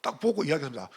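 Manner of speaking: normal talking voice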